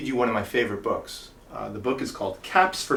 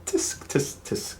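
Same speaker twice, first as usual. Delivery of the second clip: whispered tutting clicks; tsk tsk tsk; 6 dB quieter, slower than usual. Acted. Monkeys tutting back at the peddler, whispered. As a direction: tut back mockingly.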